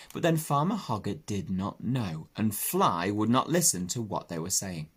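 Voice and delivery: ordinary delivery, nothing marked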